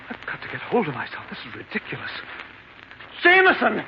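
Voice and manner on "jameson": high, loud, desperate call; a frightened master shouting for his butler at night; panicked shout. Acted. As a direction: yell for the servant in terror. Ambrose calls servant in panic.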